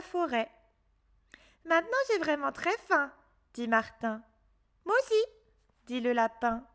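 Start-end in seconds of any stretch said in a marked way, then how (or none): 1.6-3.1 put-on higher boy's voice, quick and worried; lightly voiced worried boy; little boy Martin. A narrator's reading. Martin voice in story. 4.8-5.2 rabbit character. A narrator's reading. Rabbit voice in story.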